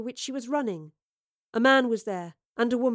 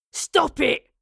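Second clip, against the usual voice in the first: sharp, loud, raised-pitch yell; 5 semitones higher, 7 dB louder than usual. A narrator's reading. Captor yelling at the girl on the table, yell. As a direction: yell it at her as an order. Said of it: "the man yelled at her".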